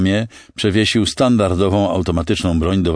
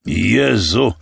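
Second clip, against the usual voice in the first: higher, startled cry, mildly raised; exclamation; 5 semitones higher, 4 dB louder than usual. A narrator's reading. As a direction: exclaim as at a near miss. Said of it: "wykrzyknął Nathan".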